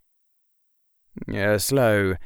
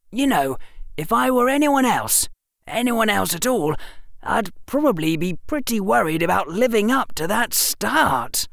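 put-on boyish, eager storytelling; young Harry voice; 12 semitones higher than usual. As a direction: voice a clever young boy. Harry continues.

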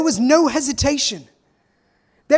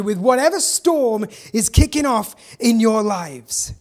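ordinary delivery

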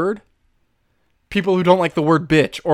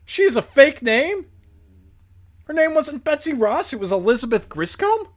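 surprised; 8 semitones higher than usual. Real, not acted. Surprised by the real name.

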